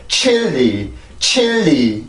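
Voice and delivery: 칠리 broken abruptly into two drawn-out Korean syllables, chil-li; wrong pronunciation demo. Shown as a demonstration. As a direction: say 칠리 the Korean way, slow, dropping off between syllables. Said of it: "절대로 그냥 뚝 떨어지면 안 돼요". (칠리를 '칠-리'로 뚝 끊어 길게 발음)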